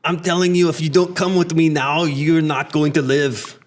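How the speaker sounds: put-on Austrian-accented action-hero warning; thick Arnold-style accent; Terminator. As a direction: play an Austrian movie cyborg. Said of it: "to the Terminator".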